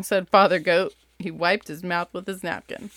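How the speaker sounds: lively bedtime-story read-aloud; reading to kids. Acted. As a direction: read it like you're sitting with your kids at bedtime. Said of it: "the same voice that I do for my kids".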